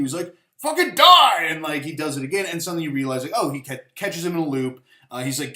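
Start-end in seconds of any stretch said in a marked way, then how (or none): 0.6-1.5 high, loud hammed-up death yell; villain screaming at the hero to die; furious shout. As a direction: bellow it like a monster in a rage. Villain kills hero again.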